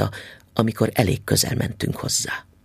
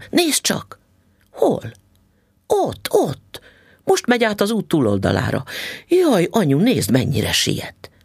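put-on excited child; 9 semitones higher than usual. A narrator's reading. Child points out man to mother.

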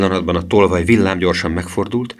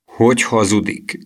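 mildly anguished, slow protest; understated helpless outrage; tearful helpless anger; 4 semitones higher than usual. A narrator's reading. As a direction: draw it out, fighting back tears. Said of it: "kiáltotta Emil könnyeivel küszködve tehetetlen dühében".

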